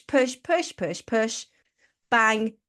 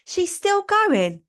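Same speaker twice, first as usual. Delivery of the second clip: exasperated adrenal glands; 3 semitones higher, 4 dB louder, slower than usual. Pretend. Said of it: "Your adrenal glands ... is like".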